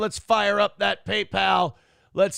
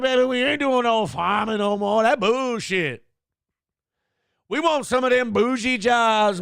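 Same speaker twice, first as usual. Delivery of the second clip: mock church preacher; 6 semitones higher than usual. Imitating a congresswoman's church-crowd voice, sassy. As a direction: ham up a sassy pulpit rant. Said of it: "gets in front of the Baptist church like".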